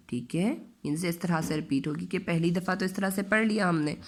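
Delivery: ordinary talk, nothing marked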